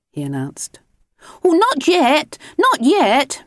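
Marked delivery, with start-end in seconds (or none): 1.4-3.4 much higher, louder pleading; begging. Acted. Children begging Mister Grinling to wait, pleading. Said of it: "begged the children".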